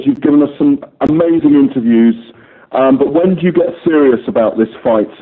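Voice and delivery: ordinary talk, nothing marked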